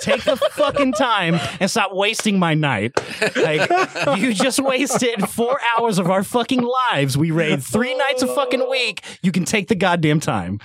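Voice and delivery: half-laughing mock tirade at a lazy raider; angry raid leader. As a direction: raid leader scolding a player who skipped the videos, laughing mock rage. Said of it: "I was that raid leader".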